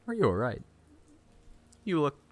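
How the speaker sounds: lightly voiced concerned character; a hint of a put-on game voice. Asking if Sarah is all right, put-on character voice. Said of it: "I'm gonna fucking do a voice".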